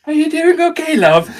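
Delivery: a hint of a caring elderly woman asking; kindly old lady. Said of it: "she actually came up to me".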